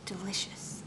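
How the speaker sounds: usual speaking voice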